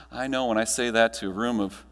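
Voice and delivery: usual speaking voice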